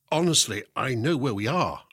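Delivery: plain reading voice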